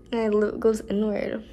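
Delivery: pulling lip aside while speaking; speaking with fingers stretching lip; talking with fingers in mouth. Real, not acted. Seen 4: fingers hold upper lip back while speaking, then hand leaves.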